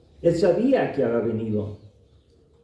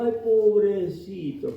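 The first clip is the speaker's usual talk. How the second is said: slow, higher sighing "Ay, pobrecito"; drawn-out pitying sigh, higher up; mock pity; 6 semitones higher, slower than usual. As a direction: parody of a weepy mourner. Mocking tearful pity.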